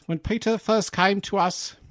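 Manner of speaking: high-pitched mock New Zealand accent; special-effects man impression. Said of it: "guy who did all the special effects".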